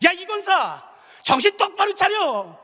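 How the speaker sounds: high, slow repeated call; shouting. Retold or imitated. Retelling the deacon's rebuke to the grieving mother, high shout. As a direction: bark the rebuke again, slowly. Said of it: "그게 할 말이에요?".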